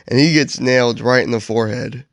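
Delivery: ordinary delivery, nothing marked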